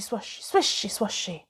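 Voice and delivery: plain reading voice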